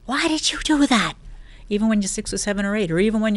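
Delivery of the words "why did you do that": higher, louder scolding parent. Retold or imitated. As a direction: imitate a parent scolding a toddler. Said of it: "your parent scolds you and says".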